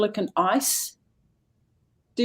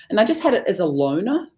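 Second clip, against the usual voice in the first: normal talking voice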